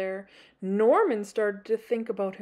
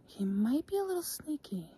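secretive whisper; hushed sneaky aside; 4 semitones higher, 7 dB quieter, faster than usual. Acted. Teasing the sneaky creature.

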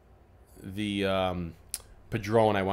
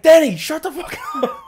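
very high, loud yell breaking into laughter; mock-outraged yell at your uncle; exasperated shout; 11 dB louder than usual. Nephew to uncle ruining his video, laughing exasperated shout.

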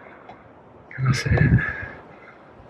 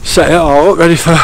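louder over the wind noise; shouting over wind; 4 semitones higher, 10 dB louder than usual. Real, not acted. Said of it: "all you're gonna hear is me frigging puffing and panting".

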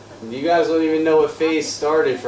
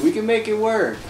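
pleading; 7 semitones higher than usual. Pretend. Pleading with game girl.

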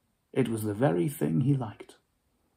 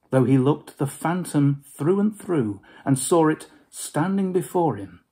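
plain reading voice

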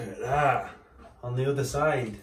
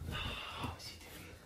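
whispering; 16 dB quieter, faster than usual. Real, not acted. Aside about hiding dog.

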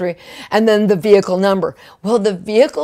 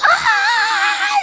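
a very high, piercing shriek; excited squeal of the team, far higher; team scream. Retold or imitated. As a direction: scream out in shock. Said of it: "my team standing behind me starts screaming".

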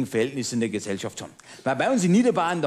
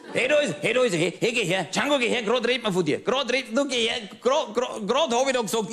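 calling out; 9 semitones higher than usual. Acted. Calls out to Django in crowd.

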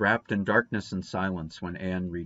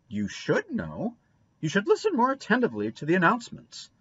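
put-on scolding old woman; 11 semitones higher than usual. A narrator's reading. Mrs Lynde scolding.

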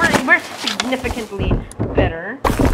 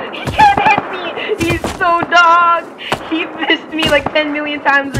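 gloating shout; 6 semitones higher, slower than usual. Real, not acted. Dodging an enemy, gloating.

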